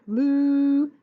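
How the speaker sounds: loud drawn-out moo; cow moo. Acted. Teacher voicing the cows with a long loud moo.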